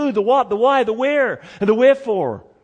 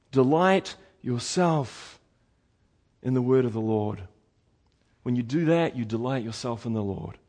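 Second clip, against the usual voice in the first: solemn counsel; 8 semitones lower, 6 dB quieter than usual. Real, not acted. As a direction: drop low and soft, letting each phrase settle. Preacher solemnly gives the key instruction.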